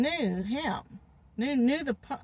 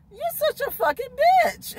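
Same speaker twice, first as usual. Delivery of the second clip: retold insult; 13 semitones higher, 4 dB louder than usual.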